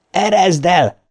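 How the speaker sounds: high, urgent cry; shouted order. A narrator's reading. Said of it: "kiáltotta". (parancsoló kiáltás)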